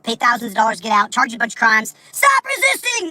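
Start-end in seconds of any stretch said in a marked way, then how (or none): 2.1-3.1 high shrieking cop yell; cop shout. Retold or imitated. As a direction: bark it like a cop. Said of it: "It's like pounding your face in".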